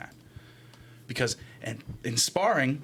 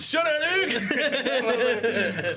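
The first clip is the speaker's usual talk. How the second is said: slow, higher words drowned in laughter; laughing; 9 semitones higher, much slower than usual. Real, not acted. To a friend about to do a Street Fighter move, laughing. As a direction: laugh through the line.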